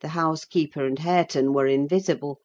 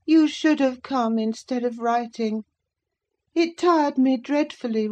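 put-on peevish sickly boy; 7 semitones higher than usual. A narrator's reading. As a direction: whine at the servant who ignores you. Linton complains to Catherine.

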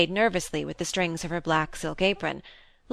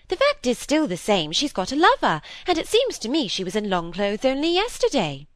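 put-on higher, emphatic older sister; impatient; 6 semitones higher than usual. A narrator's reading. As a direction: press the point with some impatience. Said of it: "said Miss Browning impatiently".

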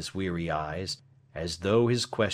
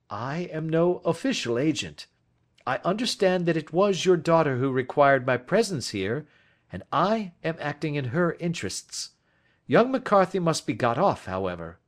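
higher-pitched, measured detective voice; composed Holmes; 9 semitones higher, 5 dB louder than usual. Acted. Detective reassuring the confessing old man, composed. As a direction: explain your position firmly, without threat.

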